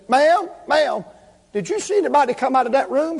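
high, quick retold hail; urgent calling out. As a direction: call after a stranger with a hurried question. Calls out to cleaner in story.

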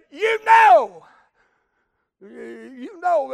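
emphatic retold cry. Woman appealing to her church about her trouble, emphatic cry.

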